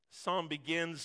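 ordinary talk

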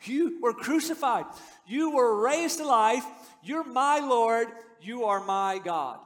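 slow and loud, building with conviction; fervent confession. Acted. Preacher voicing Thomas's confession to the risen Christ, slow and fervent. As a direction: proclaim each line like a vow. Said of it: "the only sane response".

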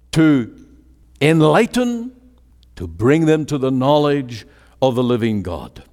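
normal talking voice

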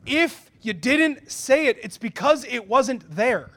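slow, loud, word by word; emphatic rebuke. Retold or imitated. Mentors correcting the wife, slow and emphatic. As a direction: land each phrase slowly and firmly. Mentors' stern verdict retold.

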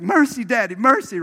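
high, loud pleading for mercy; pleading Nina. Retold or imitated. Nina begging her father to spare the boy, high and loud. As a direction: beg Daddy for mercy. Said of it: "she's like".

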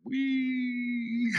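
gleeful squeal. Acted. Child splashing in the water.

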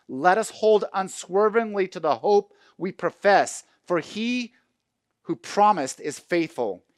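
high, loud, slowed scripture proclamation; full-force preaching. Acted. Pastor wrapping up the sermon, preaching at full force. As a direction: build the promise to a climax. Reads Hebrews, then exhorts.

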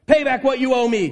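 lightly voiced creditor throttling his debtor; demanding servant. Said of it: "he demanded".

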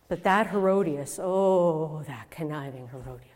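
low, sinking disdain; bitter contempt. Acted. Mother speaks of the woman behind John's death, bitter contempt.

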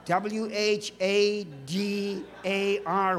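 slow, letter-by-letter spelling; mock spelling-out. Shown as a demonstration. Preacher jokingly spelling a mispronounced word, slow mock spelling. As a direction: spell it out slowly, one letter at a time. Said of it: "how do you even spell water?".

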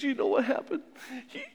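high, squeaky mock wail of complaint; hammed-up tearful complaint; upset daughter. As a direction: play the wronged wife whining to mom. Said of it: "Mary comes home and says".

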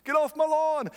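high, loud mock old-man yell; grumpy old man yelling. Old man yells at kids on his lawn, mock yell. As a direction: shout like a cranky old man at the clouds. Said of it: "the old man yelling at the clouds".

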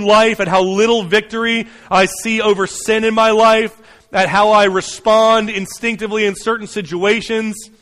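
raw, urgent prayer; anguished honest prayer. Acted. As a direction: pour out frustration straight to God.

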